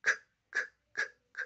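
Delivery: "k" as a short voiceless click, repeated; the k sound. Shown as a demonstration. Teacher demonstrating a single consonant sound. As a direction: tap out the "k" sound. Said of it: "lo que hace es el sonido k".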